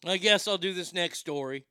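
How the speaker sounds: ordinary talk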